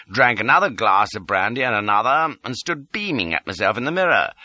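ordinary delivery, nothing marked

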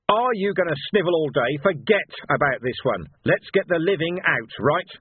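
gruff sergeant's bark. A narrator's reading. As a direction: shake the lad out of it. Vimes barks orders at crying Sam.

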